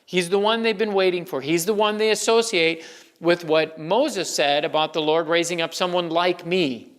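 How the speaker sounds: ordinary delivery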